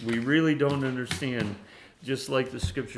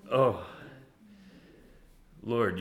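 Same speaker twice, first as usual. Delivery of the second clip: normal talking voice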